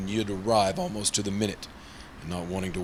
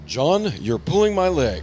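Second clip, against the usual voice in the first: higher, louder and slow, caught out; flustered protest; 7 semitones higher, 5 dB louder than usual. Acted. Harry, teased about the pretty client, protests flustered and embarrassed. Flustered under John's teasing.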